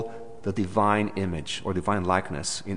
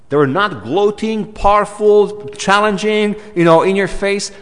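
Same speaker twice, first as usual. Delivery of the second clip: higher, louder building emphasis; rising preacher fervor; 9 semitones higher, 6 dB louder than usual. Real, not acted. Preacher stressing the demons were not gloating. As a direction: build it up with fire.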